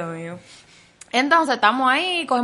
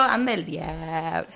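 low dismayed mutter; 7 semitones lower, 7 dB quieter, much slower than usual. Retold or imitated. His dismayed reply.

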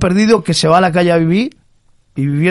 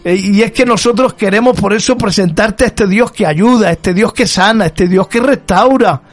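higher-pitched, emphatic preacher's voice; fervent preaching; 5 semitones higher than usual. Real, not acted. Preacher telling the church how God restores lives, fervent. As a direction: preach it with rising fervour, leaning on each declaration.

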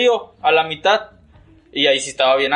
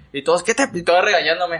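normal talking voice

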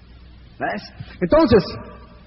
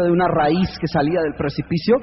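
normal talking voice

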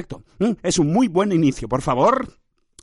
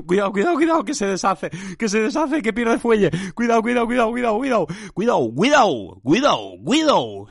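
mock alarm; 5 semitones higher than usual. Mock alarm at losing steam.

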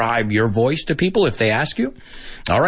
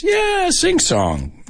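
high, slow, drawn-out sing-song; much slower than usual. Shown as a demonstration. Teacher introducing the term sing-song, in sing-song. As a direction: lilt it up high and slowly. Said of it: "The next one is sing-song".

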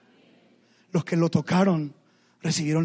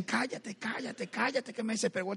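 hushing crowd; 3 semitones higher, 7 dB quieter than usual. Retold or imitated. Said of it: "Todos le decían".

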